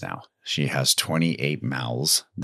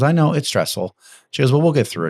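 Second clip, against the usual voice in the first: higher, lively quoted reassurance; animated retold reassurance; 9 semitones higher, 4 dB louder than usual. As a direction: retell her upbeat reply, pitched up. Owner's reassurance retold with raised energy.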